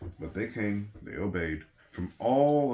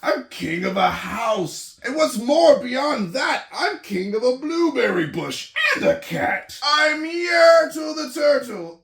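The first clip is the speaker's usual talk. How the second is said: loud, high, proud king's voice; boastful turtle king; 8 dB louder than usual. Acted. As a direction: crow like a vain little tyrant. Yertle boasting in story.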